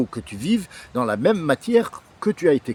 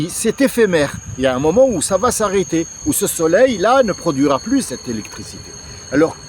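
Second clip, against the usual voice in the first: ordinary talk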